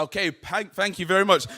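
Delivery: loud, high excited thanks; buzzing after the crowd chant; hyped crowd warm up. Real, not acted.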